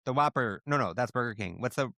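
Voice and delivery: ordinary talk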